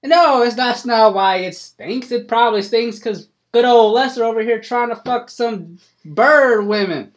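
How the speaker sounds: high, loud country voice; an old farmer happy to get his hat back; hillbilly farmer, delighted. Acted. GM voices Cletus Ray.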